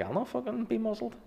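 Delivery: high indignant growl of a refusal; mock defiant Sammy. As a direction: play the stubborn politician refusing. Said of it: "He's like".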